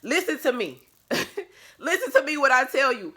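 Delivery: high, loud, laughing "listen to me"; emphatic insistence. Real, not acted. Vlogger insisting viewers hear her out, loud and high. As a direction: demand their attention, half laughing.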